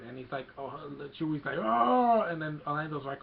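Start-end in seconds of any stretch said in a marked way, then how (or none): 1.6-2.2 loud, high imitated roar; mock wailing growl-cry; Chewie. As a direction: bellow a wordless creature howl.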